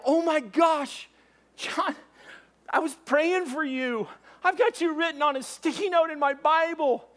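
high-pitched, amazed gushing; thrilled, breaking the big news; excited reunion. Retold or imitated. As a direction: gush to a long-lost friend, voice way up. Excited reunion with his friend.

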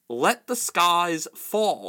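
slow, loud, strained shriek. Acted. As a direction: shriek it to the heavens. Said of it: "shrieked Rabadash".

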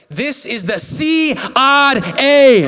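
slow, booming imitation of a caller; super loud. Preacher imitates the scammer picking up, super loud. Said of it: "just, like, super loud".

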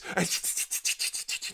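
unvoiced tongue-tapped chopping sound; soft mouth-made chopping clicks; knife sound effect. Shown as a demonstration. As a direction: rattle out a quick knife-on-board chop. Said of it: "That's my, uh, knife sound effect".

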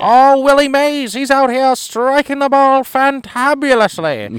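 high, slow vintage radio announcer; old-time baseball announcer. Retold or imitated. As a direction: call it like a 1950s ballgame, slow and high. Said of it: "they talk different, like, the baseball announcers".

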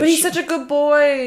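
high, cooing pet voice; doting on dog. Real, not acted. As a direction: coo over a good dog.

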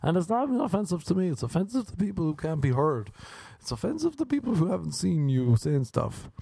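high-pitched mock whiner taking offence; offended objector. Objecting on behalf of others, mock offended. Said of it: "someone being like".

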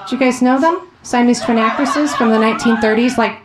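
ordinary delivery

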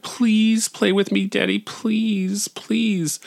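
put-on high, slow little-child begging; drawn-out, high-pitched imitation of a pleading child; pleading four-year-old. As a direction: beg like a small kid, stretching each please. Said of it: "adorable four-year-old is like".

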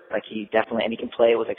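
ordinary delivery

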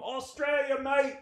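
high, soft Australian heckler voice; badly faked Australian drunk; mock Australian accent. Drunk heckler tells comic his origin, mock Australian accent. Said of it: "I don't do accents".

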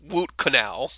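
"woot canal" with a w for the r; dental-numbed mispronunciation. Retold or imitated. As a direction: slowly say "woot canal", mimicking a numb mouth. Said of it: "to put it in her own words".

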